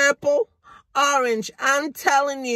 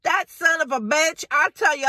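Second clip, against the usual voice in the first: ordinary delivery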